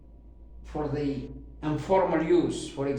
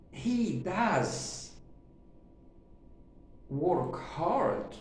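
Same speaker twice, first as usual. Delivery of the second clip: DOES raised and drawn out, then a pause; stress on DOES; 3 semitones higher, 4 dB quieter, much slower than usual. Shown as a demonstration. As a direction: insist on "does", slowly and deliberately. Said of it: "to emphasize that he works hard".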